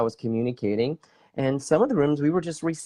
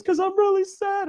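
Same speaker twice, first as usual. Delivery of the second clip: very high, whimpery imitation; mock crying. Homeowner explaining why she cries, mock tearful. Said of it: "then she starts crying".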